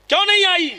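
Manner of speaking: annoyed demand. Retold or imitated. Prophet angry at servant.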